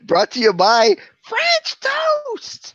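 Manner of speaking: slow, high announcer voice; hammed-up high slow sponsor read.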